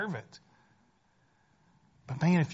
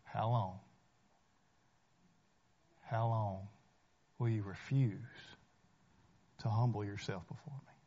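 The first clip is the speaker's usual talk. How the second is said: a gentle, searching question from the Lord; hushed solemn plea; matchless voice of Jesus; 7 semitones lower, 9 dB quieter than usual. Acted. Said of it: "with his matchless voice".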